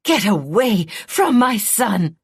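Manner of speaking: slow, loud, high shriek; mother shrieking to protect her son. A narrator's reading. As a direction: scream her away from the cradle.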